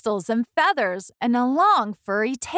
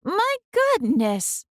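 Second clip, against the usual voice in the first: high, slow tut-tutting granny; dismayed old woman; 9 semitones higher, slower than usual. Acted. Old woman seeing Camilla's room, high slow dismay. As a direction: shake your head over it. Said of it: "she said with a shake of her head".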